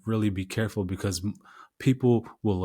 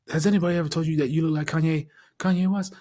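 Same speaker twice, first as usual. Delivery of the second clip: higher, quick put-on voice for the other guy; man in car, imitated; 8 semitones higher, 3 dB louder, faster than usual. Man in car asks storyteller about a lookalike, imitated in higher voice. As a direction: imitate him with a raised pitch, hurrying the question.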